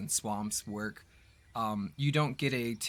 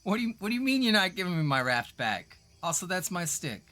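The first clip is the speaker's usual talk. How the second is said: higher, louder satyr voice; indignant satyr; 7 semitones higher, 5 dB louder than usual. Acted. Satyr demands his raft back from the party, indignant and high. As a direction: protest like a cheeky little satyr. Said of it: "And he's like".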